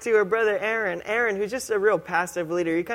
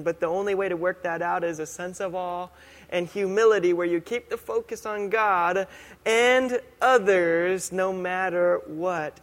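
ordinary talk

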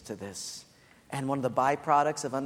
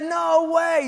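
much higher, louder mock shout of disbelief; 13 semitones higher, 8 dB louder than usual. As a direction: yell it in playful disbelief.

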